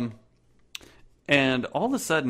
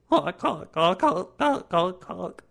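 high, loud mournful honks; sad serious honking; 6 semitones higher, 3 dB louder than usual. Acted. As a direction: honk gravely, like a sad bird. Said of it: "He's kinda saying it in a sad, but, like, in a serious tone".

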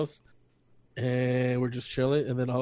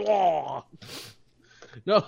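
normal talking voice

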